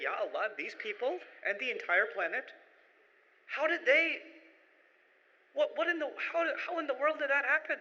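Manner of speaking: high, incredulous wonder asking how; stunned, almost laughing at the absurdity; a bewildered outcry to God; amazed disbelief. Retold or imitated. His own amazement, driving English farmland.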